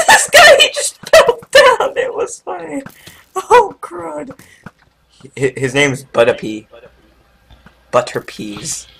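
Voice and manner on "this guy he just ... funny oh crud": high, squeaky laughing voice. Real, not acted. Laughing at a player falling.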